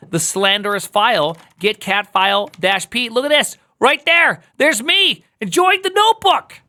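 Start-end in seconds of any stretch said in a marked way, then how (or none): 3.1-6.6 high, louder, gleeful find; excited discovery. Real, not acted. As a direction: show off the recovered file with delight. Recovered file found.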